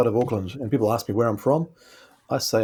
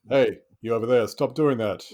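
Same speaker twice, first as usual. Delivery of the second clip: firm, commanding call across the room; authoritative voice. Shown as a demonstration. Friend demoing his authoritative voice to the hosts. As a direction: order a stranger to stop. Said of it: "I just use my authoritative voice".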